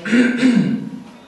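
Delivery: loud, pointed throat clear for attention. Retold or imitated. Man behind clears his throat pointedly. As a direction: ahem to get him to move.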